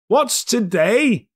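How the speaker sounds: drawn-out call. Acted. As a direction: call down to the boy in the street. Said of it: "cried Scrooge".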